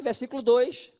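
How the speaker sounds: ordinary talk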